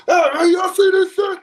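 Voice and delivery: high, loud hammed-up yell; a mock shout of someone who just has to react; mock reactive shout. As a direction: play the overexcited reactor, hammed up. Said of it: "Me reacting to something is just".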